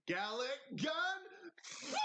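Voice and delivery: high-pitched attack call rising to a squeal; mock battle cry. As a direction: build to a hammed-up, very high cry. Imitating cartoon attack call.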